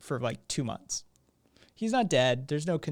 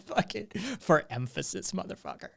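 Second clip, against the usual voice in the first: lightly hammed German accent, higher, mock ranting; mock German anger translator; 7 semitones higher than usual. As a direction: play a jokey German anger translator, half-laughing. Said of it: "Fucking anger translator with a German accent".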